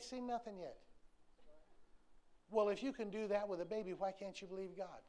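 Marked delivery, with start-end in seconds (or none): none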